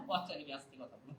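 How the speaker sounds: very soft, low, quick note to self; reminder to speak softly. Acted. Reading a pocket reminder to oneself, hushed and low. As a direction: drop right down, as if remembering.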